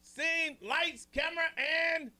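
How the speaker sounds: high, drawn-out set call; film director's call. Pretend. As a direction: call out to the crew, stretching each cue. Host plays director calling the set.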